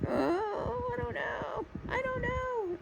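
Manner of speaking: very high, soft squeal of doubt; mock helpless whine. Frustrated artist acting out her struggle with tablet drawing, mock helpless whine. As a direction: squeak it out small and high, as if overwhelmed.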